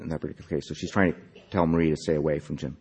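plain reading voice

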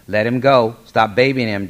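loud, high, forceful command; angry order. Acted. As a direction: order your wife off the boy. Father pulls son from mother.

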